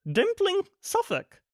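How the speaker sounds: Dimpling read high and falling, as a plain address. A narrator's reading. Nanny reads the address from the cart, Dimpling high and falling. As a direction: read the place name off the label. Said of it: "she read out".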